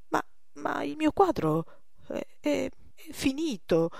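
high, halting stammer; faltering protest. Acted. Old master defends his picture as finished, faltering. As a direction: protest haltingly, stumbling over the words.

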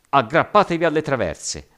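high, loud alarm call; shouted warning. A narrator's reading. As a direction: shout for everyone to hold on. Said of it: "aveva gridato Zhao".

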